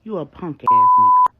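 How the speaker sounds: L J cutting off Marcus with a furious shout; angry shout. A narrator's reading. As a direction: blast it at him. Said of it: "L J cuts him off and says".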